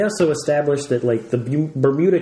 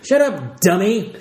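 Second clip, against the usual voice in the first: higher-pitched playful rebuke; mock put-down; 6 semitones higher, slower than usual. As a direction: act out dismissing a pest. Joins the acted put-down.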